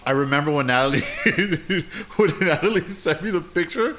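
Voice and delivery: usual speaking voice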